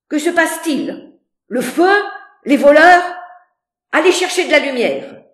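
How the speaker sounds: high, loud, panicked shouting; frightened guests crying out in the night; terrified guests. A narrator's reading. Woken guests calling for light, panicked shouts. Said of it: "terrorisés".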